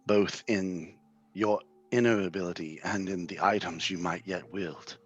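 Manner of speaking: slow, grave seer reading a fortune; an old seer foretelling a sorcerer's fate; solemn prophecy. Acted. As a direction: intone it like an omen. Reads a vision prophecy.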